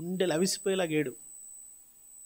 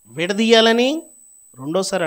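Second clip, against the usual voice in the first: emphatic shout; 4 semitones higher, 9 dB louder than usual. Real, not acted. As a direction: stretch the word out loud. Preacher stresses repeated word. (గట్టిగా సాగదీసి)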